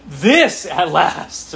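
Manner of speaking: "This" drawn out very slow, loud and high; stress on this. Shown as a demonstration. Preacher acting Adam's words, stressing "This". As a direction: lean hard and long on "This". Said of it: "emphasize the word "this".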